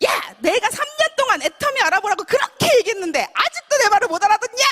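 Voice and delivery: high, loud angry outburst; scolding mother. Retold or imitated. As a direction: yell exasperatedly like a fed-up mom. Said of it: "욕을 하더라고요".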